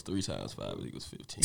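deep voice. Pretend. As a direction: drop into an exaggerated bass. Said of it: "you should be talking like this".

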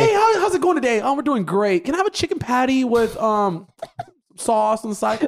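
high-pitched polite customer; fake proper voice. Shown as a demonstration. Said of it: "trying to be proper".

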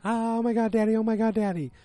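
high, excited squeal of a thrilled daughter; imitating a girl shrieking with joy; excited screaming girl.

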